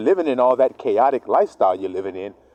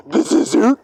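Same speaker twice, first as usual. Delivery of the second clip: cocky fool; 8 semitones higher, 3 dB louder than usual. Retold or imitated. Fool about to connect wires.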